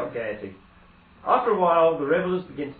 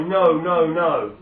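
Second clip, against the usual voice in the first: louder, desperate protest; crying out no; 4 semitones higher, 9 dB louder, much slower than usual. Acted. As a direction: cry out in horror together. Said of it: "They all cry".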